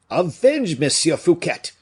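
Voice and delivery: high, loud rallying cry; excited cry. A narrator's reading. Excited follower calls the crowd to avenge Fouquet, high excited cry. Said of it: "cried the most excited of his hearers".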